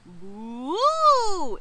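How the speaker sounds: rising, drawn-out ghostly boo; Isabel. A narrator's reading. Ghost pupil copying the others, swooping boo. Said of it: "Isabel copied him".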